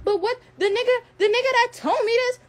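high-pitched mocking imitation of her; mimicking her. Retelling her excuse on live, mocking high voice. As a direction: put on her voice, pitched well up. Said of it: "She's like".

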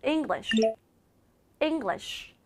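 English ending in a soft sh with pursed lips; rounded final sh. Shown as a demonstration. Teacher modelling for students, English with short rounded sh. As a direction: push the lips forward on the end of English, softly. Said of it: "입술만 살짝 앞으로 모여주시면".

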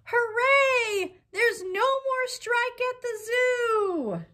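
high, loud, slow cheer; zookeeper cheering. Acted. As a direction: cheer like a joyful zookeeper announcing news. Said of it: "The zookeeper calls the reporters to say".

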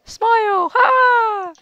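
creepy taunt. Pretend.

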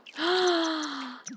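sharp excited in-breath; high delighted gasp. Real, not acted. Gasp at pet's success.

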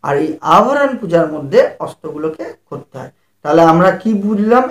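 usual speaking voice

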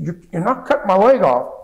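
high, loud, frantic refusal; retold agitated cry on the operating table; agitated shout. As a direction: yell it at the surgeons in panic. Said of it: "And I'm, like, really agitated".

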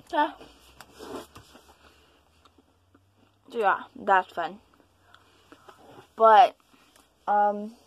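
candy in mouth; chewing while speaking; talking while eating candy. Real, not acted. Seen 0: Puts dark candy in mouth at 0.7, hand at mouth later.

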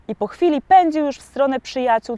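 usual speaking voice

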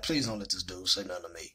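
low, soft private plea; muttered dread. Retold or imitated. Silently hoping the stranger won't talk to him, muttered dread. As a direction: plead under your breath, low.

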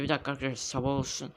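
ordinary talk, nothing marked

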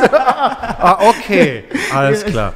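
high, loud, laughing through the words. Retold or imitated. Comedian laughing as he agrees to the nineties party.

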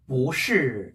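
"보" drawn out rising, "실" falling in fourth tone; weak-strong tones on "보실"; second-tone 보. Shown as a demonstration. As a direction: lift "보" slowly, then drop "실". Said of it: "이성으로 해서".

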